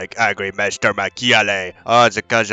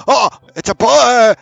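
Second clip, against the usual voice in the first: louder, higher second take; very excited; 11 semitones higher, 6 dB louder than usual. Real, not acted. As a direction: shout it out with delight. Louder repeat.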